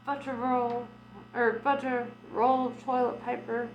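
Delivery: usual speaking voice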